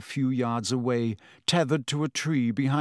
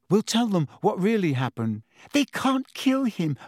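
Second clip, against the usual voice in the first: higher, rising desperate plea; desperate protest; 7 semitones higher than usual. A narrator's reading. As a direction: protest with mounting distress, pitch climbing. Friends protest against the execution.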